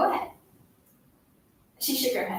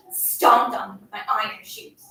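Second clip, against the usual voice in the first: ordinary delivery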